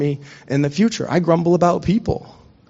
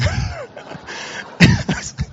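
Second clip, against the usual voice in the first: high laugh. Real, not acted. Pastor laughing at his uncle's thinning-hair joke, high laugh. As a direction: laugh out at the punchline.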